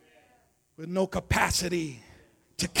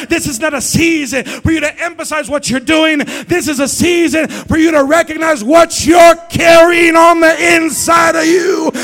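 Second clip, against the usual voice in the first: higher and shouted second take; louder repeat; 9 semitones higher, 15 dB louder than usual. Shown as a demonstration. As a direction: drive it home the second time. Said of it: "one more time for the hearing impaired".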